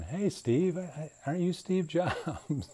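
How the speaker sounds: put-on high, eager old-man voice greeting Steve; dad in heaven meeting Steve Jobs, high and eager; imitating dad in heaven. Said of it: "and going".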